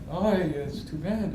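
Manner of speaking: a light take on a calm Russian mother; a hint of his mother shrugging it off; imitating mom. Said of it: "Truly, that's what she sounded like".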